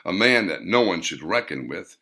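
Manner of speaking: plain reading voice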